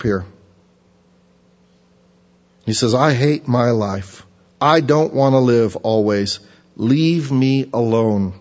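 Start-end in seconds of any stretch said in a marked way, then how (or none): 2.9-8.3 slow despairing plea. Acted. Preacher voicing Job's despair.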